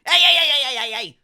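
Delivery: high loud flustered protest; protesting old man. Acted. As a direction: object to being picked up. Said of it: "He's like".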